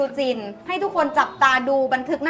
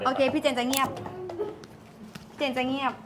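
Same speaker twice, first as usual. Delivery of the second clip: dropping her voice to stay quiet; quieter voice; 9 dB quieter than usual. Real, not acted. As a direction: keep it down so the pet listens.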